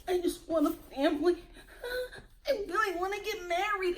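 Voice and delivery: high, quick, soft mock sobbing; mock crying. Host imitating a man crying in the confessional, mock tearful. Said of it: "he's, like, in the confessional crying and shaking".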